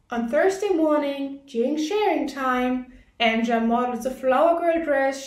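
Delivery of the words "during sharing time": usual speaking voice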